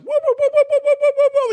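very high loud whoop run; whooping legs. Acted. Third guy whoops loudly as his legs stretch up and down. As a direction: shout the stretching noise over and over.

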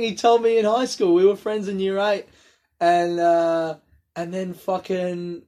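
ordinary talk, nothing marked

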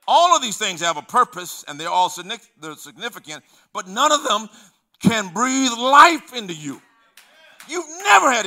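loud, high, slowing on the last words; preaching at full force. Acted. Preacher telling church issues cannot give life, loud. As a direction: lift it like a sermon peak.

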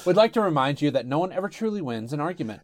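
fast low mock-announcer outro; sign-off read. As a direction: rattle off the show's sign-off. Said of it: "sign-off read".

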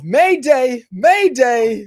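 loud, high mock distress call; mayday call. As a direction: call it out like a sinking ship. Mock distress call about Kickstarter.

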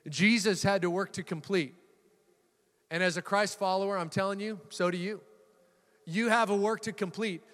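normal talking voice

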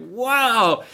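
high, loud burst of wonder; amazed. Acted. Preacher voicing Israelites' reaction to the miracle, amazed. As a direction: act out being awestruck.

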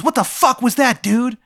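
high squeaky tattoo creature. Acted. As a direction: pitch way up as the angry little tattoo. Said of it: "And he goes".

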